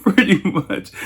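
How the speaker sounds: high, squeezed out after a laugh. Real, not acted. Man cracking up at his own story, laughing. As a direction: still cracking up as you speak.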